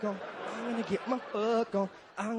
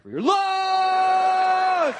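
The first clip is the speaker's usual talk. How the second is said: high, drawn-out yell; sudden mock-ecstatic holler; climactic shout; 8 semitones higher, 9 dB louder, much slower than usual. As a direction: explode on the last word and hold it. Climax in funeral impression.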